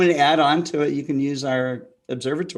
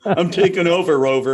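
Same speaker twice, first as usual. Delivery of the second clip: playful mock dog voice, louder; 5 semitones higher, 7 dB louder than usual. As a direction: voice the dog taking over.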